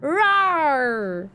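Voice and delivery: loud dinosaur roar. Acted. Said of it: "Sorry, that was my stomach".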